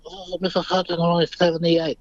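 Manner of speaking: gently imitating an old film star being meek; lightly voiced Brando. Marlon Brando voiced in story.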